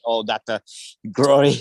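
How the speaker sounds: usual speaking voice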